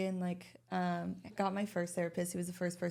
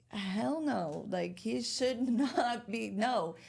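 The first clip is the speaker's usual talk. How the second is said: mock outraged friend; 5 semitones higher, 4 dB louder than usual. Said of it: "there's people like".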